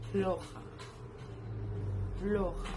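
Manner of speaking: loud, isolated "floja" for learners; pronunciation demo. Shown as a demonstration. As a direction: punch out "floja" as a model word. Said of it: "pero se pronuncia".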